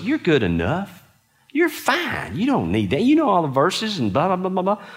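smooth-talk him into pride; a sly, sing-song Satan flattering; Satan's lie. Retold or imitated. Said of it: "his lie to me was".

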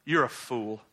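usual speaking voice

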